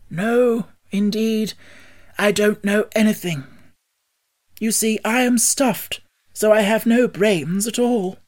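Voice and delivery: high-pitched, mildly sorrowful Scarecrow; sad. A narrator's reading. Scarecrow admits he has no brains, mildly sad. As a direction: confess it with a touch of sadness. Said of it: "he answered sadly".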